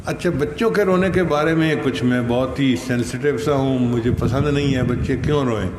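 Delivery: ordinary talk, nothing marked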